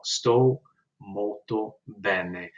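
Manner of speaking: each syllable stretched for learners; slow model phrase. Shown as a demonstration. Teacher slowly modelling Italian for students. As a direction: spell it out slowly for the class. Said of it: "teacher repeats phrase slowly".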